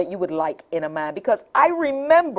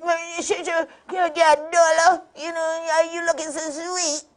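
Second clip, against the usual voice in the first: higher, lightly voiced pushy flirt; toothless flirt; 9 semitones higher than usual. Man at gas station asking for a dollar, lightly voiced flirt. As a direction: play a toothless guy hitting on her. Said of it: "and he said".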